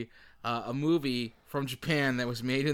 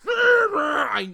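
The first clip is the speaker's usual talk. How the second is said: sudden shout; 14 semitones higher, 10 dB louder than usual. Acted. The translator shouts at the monster.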